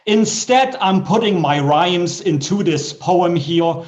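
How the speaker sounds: bouncy comic poem reading with a rhyme beat; poem recital. Acted. Poet reciting a comic poem to the open mic. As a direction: perform the silly rhyme for the crowd.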